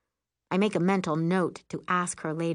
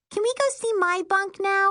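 put-on very high small-child voice; high-pitched little-girl pleading; impatient little girl; 4 dB louder than usual. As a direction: pipe up as a restless little kid.

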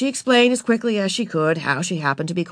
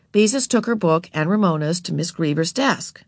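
plain reading voice

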